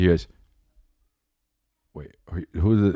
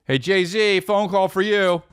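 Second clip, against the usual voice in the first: higher, louder call-out; mock phone call; 12 semitones higher, 3 dB louder, slower than usual. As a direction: announce the call teasingly.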